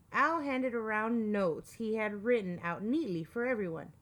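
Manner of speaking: plain reading voice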